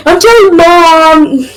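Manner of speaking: put-on high, drawn-out childish tattle; hammed-up sing-song threat; mock tattling. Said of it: "just like that one mother figure".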